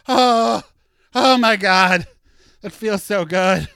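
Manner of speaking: loud, high rapturous sigh of relief; delighted gush. Real, not acted. Dreaming of sunny LA after Seattle, loud delighted gush. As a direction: savor it like warm sun. Imagining sunny Los Angeles weather.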